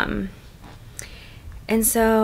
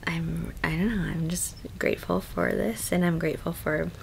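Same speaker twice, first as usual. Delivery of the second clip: whispering; 3 semitones lower, 6 dB quieter than usual. Real, not acted. Late-night quiet vlog chat.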